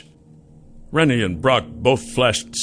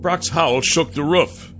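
usual speaking voice

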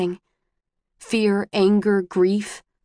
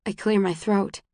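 plain reading voice

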